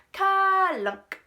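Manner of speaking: high, loud clunk of a giant switch; loud sound effect. A narrator's reading. Narrator voicing the sun's off switch clunking. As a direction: bang the word out like machinery.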